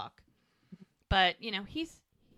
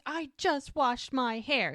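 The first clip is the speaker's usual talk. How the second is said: higher, slowed indignant protest; mock outrage; 6 semitones higher, 4 dB louder, slower than usual. Girl with cookie dough in her hair, mock-indignant protest. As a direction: stretch out each word in playful dismay.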